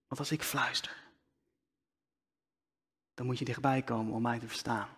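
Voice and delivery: soft hushed near-whisper; demo whisper. Shown as a demonstration. Preacher showing the congregation a whisper. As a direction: drop to a hush to draw them close. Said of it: "Nu niet, want mijn microfoon staat aan".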